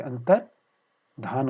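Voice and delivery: अंतर्धान split slowly, "antar... dhan"; demonstrated pronunciation. Shown as a demonstration. Teacher showing where r is sounded, slowly split. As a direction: pause after antar in अंतर्धान. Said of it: "उच्चारण करके देखिए".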